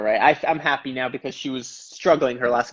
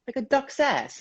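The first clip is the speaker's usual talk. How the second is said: much higher mock grandma; high, softer mimic of grandma; grandma's blunt remark; 11 semitones higher, 4 dB quieter, slower than usual. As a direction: squeak it out as grandma. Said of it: "she's like".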